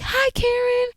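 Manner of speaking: high-pitched, familiar hello, imitated; familiar greeting. Store worker greeting the podcaster she recognized, imitated high familiar greeting. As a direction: greet them like an old acquaintance, way up high. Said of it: "she said it in such a familiar tone".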